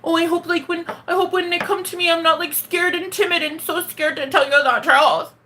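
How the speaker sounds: high, fretful, hammed-up timidity; worried little whine in mockery; mock scared self. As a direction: parody your own anxious people-pleasing. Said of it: "the version I've been lately is like".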